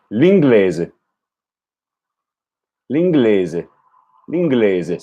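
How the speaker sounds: L'inglese, listening to the last vowel; repeating Italian. Shown as a demonstration. Learner checking the final vowel, careful L'inglese. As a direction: end L'inglese on an open eh. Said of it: "what's that final vowel sound".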